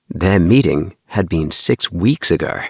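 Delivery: usual speaking voice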